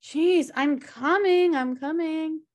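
groaning shout to a honking car; annoyed call-out. Acted. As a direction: call back irritated from the doorway.

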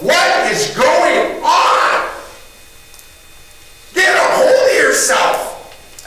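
high, loud, slow yell at himself. Retold or imitated. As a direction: shout yourself back to your senses. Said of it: "and I yelled".

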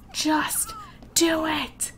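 exasperated slow-motion just do it; slow drawn-out plea. Real, not acted. Pleading with her Sim again, very slow and drawn out. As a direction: stretch each word very slowly.

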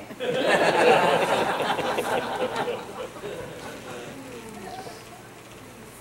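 long real laugh; joyful laugh at the memory. Real, not acted. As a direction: laugh with joy. Laughs after being saved.

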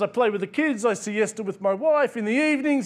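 put-on a touch of Mexican accent, easygoing. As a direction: lightly voice a relaxed Mexican fisherman. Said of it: "I apologise for the accent".

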